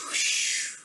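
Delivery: breathy rushing whoosh like snow crashing; avalanche whoosh. Shown as a demonstration. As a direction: blow out a long airy whoosh. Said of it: "we're going to add an avalanche sound".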